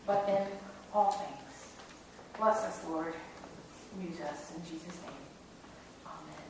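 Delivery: hushed, slowing congregational prayer. Real, not acted. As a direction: pray reverently, letting the ends of phrases drop. Leads a prayer.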